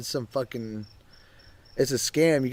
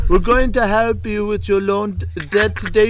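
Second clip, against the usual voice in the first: higher, louder put-on salesman voice; mock scam caller pitch; 9 semitones higher, 8 dB louder than usual. Friend mocking a scam caller's loan pitch, high put-on voice. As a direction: push the fake helpful offer.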